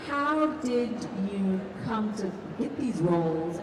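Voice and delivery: usual speaking voice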